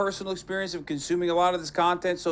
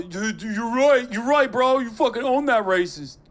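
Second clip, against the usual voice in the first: mock bro hype; 5 semitones higher, 4 dB louder than usual.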